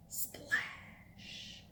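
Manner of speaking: breathy whisper on splash. Shown as a demonstration. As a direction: whisper it like falling water. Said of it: "Can you splash like a drip of water?".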